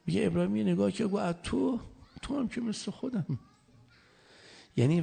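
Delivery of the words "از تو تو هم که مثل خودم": a lightly raised voice for Abraham, unhurried; Abraham's calm reply. Acted. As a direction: answer the angel without fear. Abraham answers Gabriel in story. (پاسخ آرام ابراهیم به جبرئیل، با صدای کمی بالاتر)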